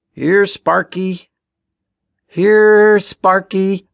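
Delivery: high, drawn-out singsong call; calling a dog. A narrator's reading. Ben calling for Sparky on the tracks, drawn-out call. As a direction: stretch the words out loudly for a lost dog. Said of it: "Ben called".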